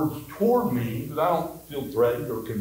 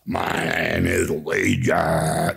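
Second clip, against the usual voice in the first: very low, drawn-out demon impression; 9 semitones lower, much slower than usual. Shown as a demonstration. Preacher imitating demons on TV, low demonic voice. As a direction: do a slow movie demon, pitched way down. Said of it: "if demons talk like they do on T V".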